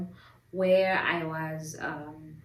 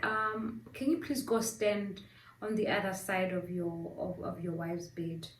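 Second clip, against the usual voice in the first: softly spoken doctor; 7 dB quieter than usual. Retold or imitated. Doctor gently directing husband.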